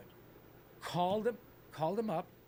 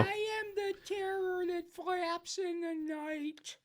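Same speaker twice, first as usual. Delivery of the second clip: much higher, very slow cartoon voice; character voice; 13 semitones higher, much slower than usual. Voice swap game, the same line in a very slow, high cartoon voice. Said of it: "say the same line in one of your characters".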